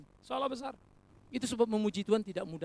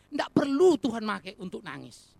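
ordinary talk

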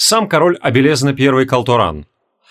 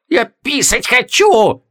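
high, slow, whining wail; drawn-out plaintive howl, higher and slower; 9 semitones higher, much slower than usual. A narrator's reading. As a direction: wail it like a desperate child. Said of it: "Жалобно взвыл Логмер".

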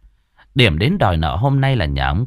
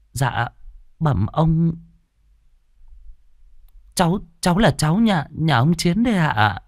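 timid; 7 semitones higher than usual. Acted. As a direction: answer shyly, stumbling. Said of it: "Con cái lành rụt rè".

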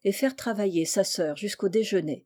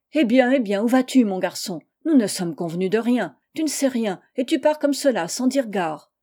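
acted blustering general, louder voice; raised-voice remonstrance; loud protest; 2 semitones higher, 5 dB louder than usual. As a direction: call him back, taken aback. General stops man who is leaving.

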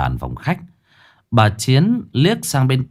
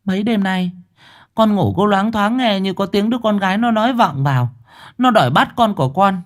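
trembling account; 10 semitones higher than usual. Acted. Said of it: "cũng run run kể".

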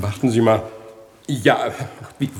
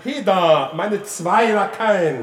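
drawn-out call; 5 semitones higher, slower than usual. Acted. Master calling for his lackeys.

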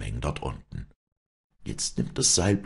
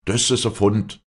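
higher, louder glad cries; crowd calling out; 6 semitones higher, 5 dB louder than usual. Acted. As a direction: hail the rescuer together. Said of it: "riefen die Leute durcheinander". (freudiges lautes Rufen)

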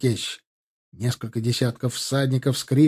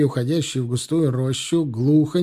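ordinary delivery, nothing marked

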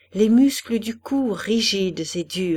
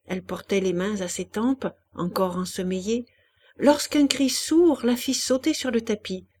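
usual speaking voice

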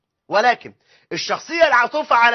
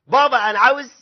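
lightly voiced kid pestering dad, a bit louder; demanding child; 4 semitones higher, 6 dB louder than usual. Child asking dad for yet another toy, mildly demanding. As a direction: call out to dad with a higher-pitched request.

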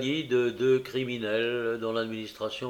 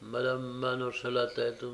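usual speaking voice

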